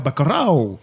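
Bacurau said the Brazilian Portuguese way, like a proud Brazilian; Brazilian accent. Shown as a demonstration. Podcast host names a Cannes film in a Brazilian accent. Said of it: "J'adore mon accent brésilien".

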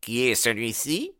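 curt question from a rival; brusque. A narrator's reading. Baldwin asking about the stranger, brusque. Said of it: "demanda-t-il brusquement".

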